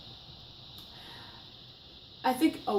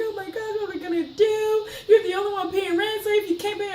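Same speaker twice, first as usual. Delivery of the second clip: panicked friend; 9 semitones higher than usual. Retold or imitated. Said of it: "I remember Ashley was crying".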